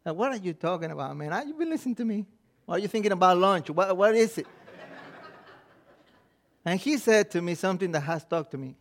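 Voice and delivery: usual speaking voice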